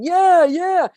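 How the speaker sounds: loud, high mock agreement; a friend fueling the fire; egging on. Bad friend stirring up anger, loud mock agreement. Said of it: "throw more mud at you going".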